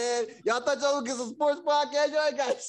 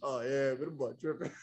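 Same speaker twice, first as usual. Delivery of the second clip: much lower, soft gossipy voice; old ladies gossiping; 10 semitones lower, 8 dB quieter than usual. Retold or imitated. Old ladies gossiping on the phone, low soft voice. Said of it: "Everybody on the phone, the old ladies".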